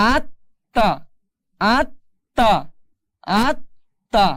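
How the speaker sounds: "আত্মা" as at-ta, held on the doubled t; doubled consonant. Shown as a demonstration. Teacher drills the tashdid for learners, "আত্মা" with a held double t. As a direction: split "আত্মা" into at, a held stop, then ta. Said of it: "তাহলে উচ্চারণ হচ্ছে কি".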